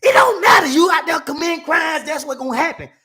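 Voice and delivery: high, loud outburst easing at the end; acted-out scolding, bursting out loud; angry lawyer. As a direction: cut the client off in exasperation. Lawyer erupts in acted quarrel.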